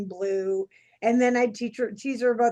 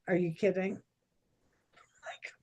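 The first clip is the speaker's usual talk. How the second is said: quiet, dead serious retort; 4 semitones lower, 6 dB quieter than usual. Retold or imitated. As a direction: drop the joking and say it gravely, like you mean it. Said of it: "she gets dead serious".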